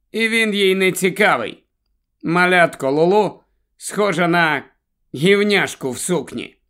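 ordinary delivery, nothing marked

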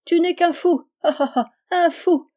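high, loud mocking laughter in the words; ringing laugh. A narrator's reading. Girl stopping to call him a fool, laughing loudly. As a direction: laugh derisively as you fling the insult. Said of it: "éclata d'un rire retentissant".

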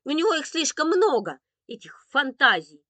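huffy dismissal; scornful snort. A narrator's reading. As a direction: scoff loudly at a brother's fantasies. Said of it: "фыркнула Виктоша".

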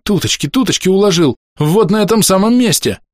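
high-pitched, whining insistence; растерянные оправдания. A narrator's reading. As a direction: insist helplessly. Orderly insists in panic. (жалобно уверять высоким голосом)

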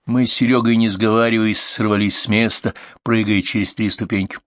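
ordinary delivery

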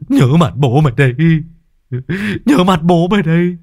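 loud, high, whimpering yell; tearful threat. A narrator's reading. Beaten young men threatening the departed bully, tearful yelling. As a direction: shout defiance through a sob, voice climbing. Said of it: "Hai thằng thanh niên mếu máo".

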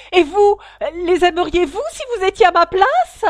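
high, very loud outraged shriek; piercing angry cry. A narrator's reading. The mouse answers Alice's talk of cats, piercing angry cry. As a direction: shout it in a piercing, indignant voice. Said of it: "d'une voix perçante et colère".

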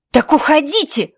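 high, loud angry shout. A narrator's reading. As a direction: yell it at her. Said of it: "крикнула Кэти". (Кэти кричит на помощницу отца)

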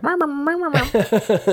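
squeaky high meowing gibberish; Animal Crossing babble. Retold or imitated. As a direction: chirp it like a tiny villager. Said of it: "Animal Crossing speak".

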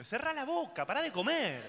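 mock the doctor telling you off; imitating a bossy doctor; doctor's scolding. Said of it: "el médico me dijo que".

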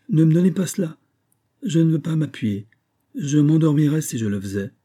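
high, loud, irritated protest; impatient refusal. A narrator's reading. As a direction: push the offer away impatiently. Irritably refusing the offered cushion.

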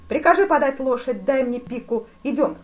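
loud, high brisk orders; commanding. A narrator's reading. Duke orders his adjutant. (повелительно и громко)

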